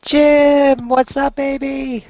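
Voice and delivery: high, held notes, slowing on the tail; high drawn-out greeting. Real, not acted. Friend greeting Jim as he joins, high drawn-out call. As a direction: call out very high, stretching the words.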